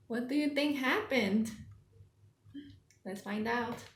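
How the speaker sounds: usual speaking voice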